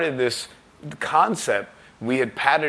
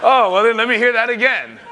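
louder and higher, suddenly all ears; mock eager surprise; 10 semitones higher, 8 dB louder than usual. Label man suddenly eager to hear the French band, louder and higher. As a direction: gush like the label man changed his tune.

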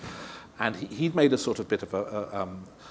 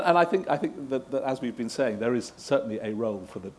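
usual speaking voice

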